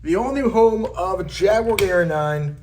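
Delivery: slow, grand reveal announcement; showman reveal. Acted. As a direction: unveil it like a game-show host. Grand reveal of new studio.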